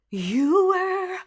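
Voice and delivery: "You" sung as a soft breathy "woo", high; breathy W onset. Shown as a demonstration. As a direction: start "You" airy like a W. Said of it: "because we want it quiet".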